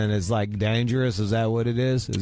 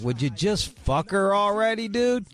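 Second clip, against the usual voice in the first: high, whiny mock complaint through the wall; exasperated neighbor; 10 semitones higher than usual. Fed up with the guy next door, high mock whine. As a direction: squeak it out fed up. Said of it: "I'm like".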